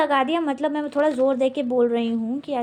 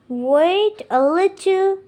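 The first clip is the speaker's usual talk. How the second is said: higher, louder and very slow teacher demo; slow model phrase; 5 semitones higher, 6 dB louder, much slower than usual. Shown as a demonstration. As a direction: drag each word out for the learner. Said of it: "मतलब थोड़ा इंतज़ार करो". (ऊँची, ज़ोरदार और बहुत धीमी आवाज़ में)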